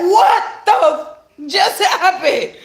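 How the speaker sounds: high, loud stunned reaction; shocked disbelief. Retold or imitated. Acted shocked reaction to a performance.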